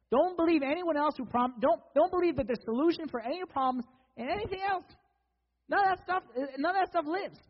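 urgent, high-pitched loud preaching; heated sermon climax; impassioned preaching. Acted. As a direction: warn the congregation, voice pushed high. Preaches at raised force.

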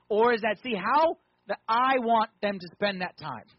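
loud, high, full-force preaching; forceful preaching. Acted. Preacher voicing God watching how blessings get used, loud and urgent. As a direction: build it up and drive it home loudly.

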